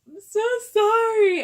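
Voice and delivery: loud, high mock-tearful wail; wailed apology. Prankster begging coworker's forgiveness, wailing. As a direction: cry out the apology, hammed up.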